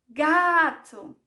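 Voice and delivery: "Gato" called out loud, GA held long; long, loud first syllable in "Gato"; calling out. Shown as a demonstration. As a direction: summon "Gato" with a swell on "ga". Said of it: "Mas eu vou chamar".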